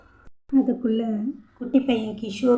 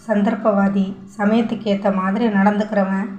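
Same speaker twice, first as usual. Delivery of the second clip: usual speaking voice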